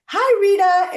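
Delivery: high, loud cheery hello; excited greeting. Retold or imitated. People greeting Rita at the convention, loud and high. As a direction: call out "Hi, Rita" like greeting a friend. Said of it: "a lot of people went like".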